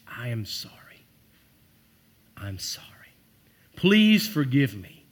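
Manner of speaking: whisper, slowing on please forgive me; hushed heartfelt whisper; whispered apology. Shown as a demonstration. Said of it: "sorry is okay to overuse in this context".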